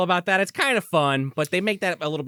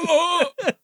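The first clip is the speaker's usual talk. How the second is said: stunned whimper; 12 semitones higher than usual. Retold or imitated. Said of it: "And he's like".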